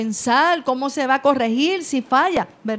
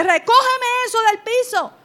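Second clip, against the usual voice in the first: high, loud shouted command; yelling order; 9 semitones higher, 4 dB louder than usual. Shown as a demonstration. Parent yelling at child from the first time, loud shout. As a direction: bark it at the child. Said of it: "desde la primera dices".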